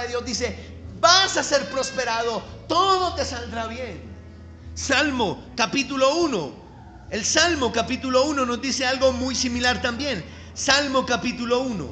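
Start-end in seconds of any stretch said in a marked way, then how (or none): none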